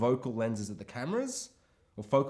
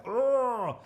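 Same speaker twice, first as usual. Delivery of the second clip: lightly hammed-up grunt; mock killer grunt; 7 semitones higher than usual. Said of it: "the killer, is like".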